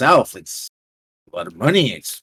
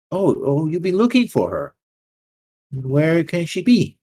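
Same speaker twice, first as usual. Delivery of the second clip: mocking disbelief; 7 semitones higher than usual. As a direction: sneer the question back at him. Moneylender mocking.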